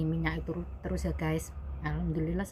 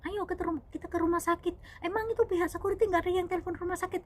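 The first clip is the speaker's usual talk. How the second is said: high-pitched, indignant fussing; agitated urging; 13 semitones higher, faster than usual. Retold or imitated. Boss urging injured maid to hospital, agitated urging. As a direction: imitate a worked-up boss pressing for action.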